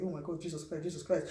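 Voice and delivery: terrified hushed gasp of prayer; scared prayer. Retold or imitated. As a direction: pray under your breath in fear. Terrified before the fight.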